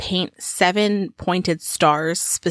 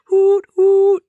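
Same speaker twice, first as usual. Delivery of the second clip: high, loud double hoot; silly owlish whistle imitation; drunk owl hoot; 11 semitones higher, 6 dB louder than usual. Shown as a demonstration. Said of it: "like a drunk owl".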